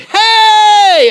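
long, high, loud cheer; rousing shouted greeting. Retold or imitated. Whole group jumping up to greet the Australian, shouting.

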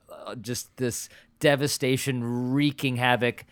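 normal talking voice

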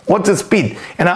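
normal talking voice

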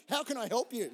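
hammed-up cheerful helper; chirpy helpful. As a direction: brightly offer help like a shop clerk.

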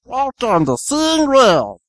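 high, slow cartoon meatball voice; hammed-up cartoon kid voice; Meatwad impression. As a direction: squeeze it up high and drag it out. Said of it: "your Meatwad impression".

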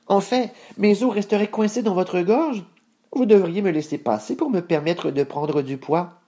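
high-pitched, reasonable goat voice; unruffled goat, pitched high; calm middle goat. Acted. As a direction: argue up high, keeping cool. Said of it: "Moyen Bouc garda son calme".

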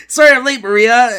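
high, loud giddy quote of Deadpool; excited high retelling. Retold or imitated. Fan retelling a Deadpool comic scene, high and giddy. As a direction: blurt it out giddy, near laughing.

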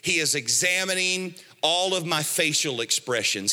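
ordinary talk, nothing marked

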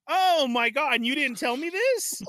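high, loud cry of disbelief at the news; outraged disbelief. Retold or imitated. To the neighbor about the new lock, high outraged disbelief. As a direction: throw it up high in shock.

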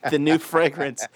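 ordinary delivery

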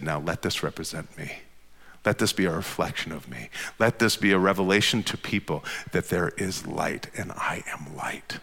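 low, soft voice, fading to whisper; hushed divine declaration. Acted. Preacher voicing God to congregation, hushed declaration. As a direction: speak softly as God, slowing into a whisper. God's words voiced in hush.

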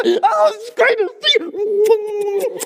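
high, loud, slow laughing cry of joy; joyful reunion. Acted. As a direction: hug the lost son, laughing and tearful. Father embraces returning son.

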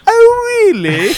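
very high, loud British "Oh, really?"; British accent. Retold or imitated. Podcaster imitating friend Katie's British accent, high and loud. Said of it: "she'll be like".

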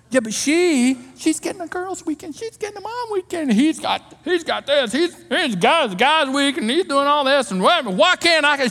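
mock the envious spouse; lightly whined complaint; jealous whine.

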